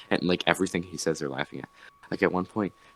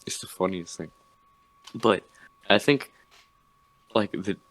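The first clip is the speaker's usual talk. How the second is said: ordinary talk, nothing marked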